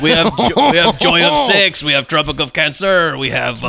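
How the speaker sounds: slow, badly faked French accent; hammed-up French seducer; cartoon French accent. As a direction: list titles like a cartoon Frenchman. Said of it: "It's a cartoon Frenchman".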